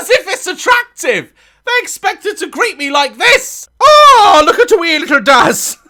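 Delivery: loud, high Irish cooing; high mocking Irish voice; mock Irish girl. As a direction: gush in a high Irish lilt. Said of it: "they're Irish all of a sudden".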